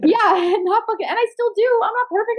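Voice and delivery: self-mocking high-pitched admission; high laughing confession. Real, not acted. Laughing confession, much higher.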